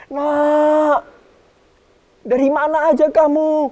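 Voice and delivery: high, eager call to a returning son; a hint of a loving father. The father embracing his lost son, calling out gladly. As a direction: call out to him, glad and surprised.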